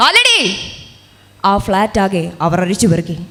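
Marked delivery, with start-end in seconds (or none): 0.1-0.4 accusing shout. Acted. Soldier accusing Sophie of smuggling meat, shouting.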